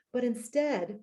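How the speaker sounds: ordinary delivery, nothing marked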